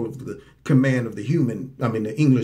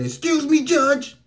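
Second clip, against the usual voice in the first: mock yelling out; 11 semitones higher, slower than usual. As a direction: shout it out of turn from the back. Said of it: "Who's that yelling back there?".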